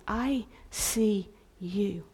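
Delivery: soft slow loving voice; gentle tenderness. Acted. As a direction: say it lovingly and softly. God voiced gently to listener.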